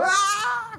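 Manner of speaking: high, short imitated kid's yell "ah"; child's mock scream. Preacher imitates a child running around screaming, high mock yell. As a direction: squeal like a running kid.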